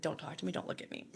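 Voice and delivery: quoted quietly, fading to a voiceless hiss; a friend hissing at him to keep quiet; hushed warning. Retold or imitated. Friend Dom silencing him, quoted.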